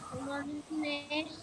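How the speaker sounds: faint, distant greeting; much too soft to hear; barely audible. Real, not acted. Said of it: "Harus kuat suara sayang". (suara sangat pelan)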